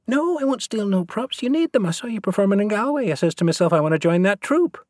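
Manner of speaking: put-on high, tumbling Irish Traveller boy's chatter; thick Irish Traveller accent. A narrator's reading. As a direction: run the words together in high Irish Traveller brogue. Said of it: "the speech fast with garbled words".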